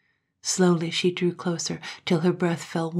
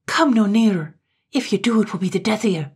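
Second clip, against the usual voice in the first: louder and higher, threatening; fierce warning; 4 semitones higher, 7 dB louder, faster than usual. A narrator's reading. Katrine warning Gloom to keep away. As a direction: hold him off with a fierce warning.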